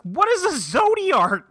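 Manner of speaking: high, slow mock-baffled question; exasperated bafflement. Acted baffled reply in retelling.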